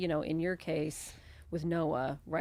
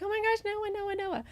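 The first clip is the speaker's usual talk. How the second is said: put-on high, squealing kids calling Noah; excited kids. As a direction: gush like thrilled children. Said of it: "They're like".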